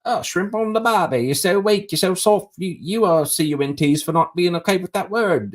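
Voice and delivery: high, hammed-up Australian accent; mocking the Australian accent as a jeering fan. Australian fans jeering Nashville supporters on Twitter, in a mock Australian accent. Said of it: "So there's Australian fans".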